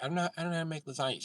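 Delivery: put-on high, fast, flustered girl voice. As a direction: squeak it out fast like a clueless girlfriend.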